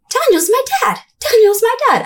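put-on high, boastful little-kid voice; imitating kid siblings. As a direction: chant it like a bragging child. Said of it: "my siblings being like".

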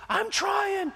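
high, loud frustrated cry; exasperated shout. Retold or imitated. Wife shouting back during the car argument, high and loud. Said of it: "She's like".

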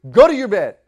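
loud, high furious shout; angry yell. Retold or imitated. Dad sends his son to bed, yelling angrily. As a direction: bark the order at the child. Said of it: "yelling and screaming".

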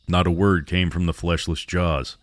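plain reading voice

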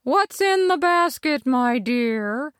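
high, put-on old granny voice; wolf faking a sweet granny; wolf's Granny voice. Wolf in bed to Little Red, high fake granny voice. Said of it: "in his best Granny's voice".

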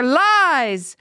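high, drawn accusation; farmer's shout. A narrator's reading. Farmer interrupting the girl's defence, high shout. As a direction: cut in with a high accusing cry. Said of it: "a farmer shouted".

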